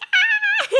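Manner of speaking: very high, loud imitated shriek; hammed-up scream while running away; mock fleeing scream. Recapper imitating nurse running from the pistol, high mock scream. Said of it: "screaming for Doctor Moore".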